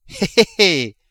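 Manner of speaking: a greedy little hé hé hé; miser's chuckle. Acted. Old miser gloating to the notary, cackling.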